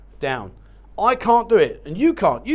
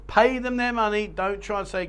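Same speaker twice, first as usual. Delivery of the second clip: ordinary talk, nothing marked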